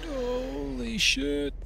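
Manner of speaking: slow, drawn-out awe; stunned. Real, not acted. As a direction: gasp it out slowly. Shocked in game.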